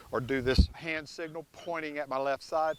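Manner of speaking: ordinary delivery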